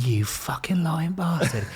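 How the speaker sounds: slow, whispered mock accusation. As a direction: tease the old recruiter with pretend outrage. Confronting recruiter years later.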